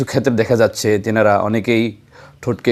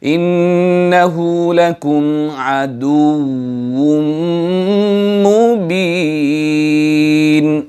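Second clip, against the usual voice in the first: very slow melodic chant, long held notes; Quranic recitation; 3 semitones higher, much slower than usual. Shown as a demonstration. Teacher recites the verse for viewers, slow and melodic. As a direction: recite it slowly, waw kept voiced.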